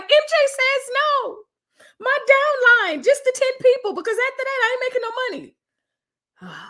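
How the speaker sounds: very high-pitched, wailing mock protest; protesting wife. Wife refusing her husband dinner, high mock wail. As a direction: whine it out like a put-upon wife. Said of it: "And she was like".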